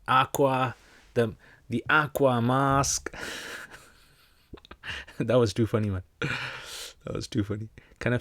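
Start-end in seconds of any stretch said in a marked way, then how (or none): 0.1-3.1 higher, slow, exaggerated American accent; Trump. Viewer requests it, mock Trump American accent. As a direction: stretch the words in a hammed-up American accent. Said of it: "Do the Trump accent".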